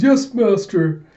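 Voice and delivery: slow, high, loud servant's reply; drawn-out minion voice; obedient servant. Acted. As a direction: play an eager minion answering his master. Servant answers his master.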